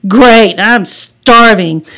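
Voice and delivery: loud, drawn-out hungry cheer; eager exclamation. Acted. Kid at a campsite, eagerly exclaiming. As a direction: stretch out the starving with relish.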